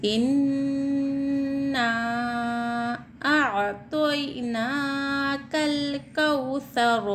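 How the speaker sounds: slow, drawn-out chanted recitation; Quran recitation. Shown as a demonstration. Teacher reciting the verse for the students, slow chant. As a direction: recite it slowly, stretching the vowels.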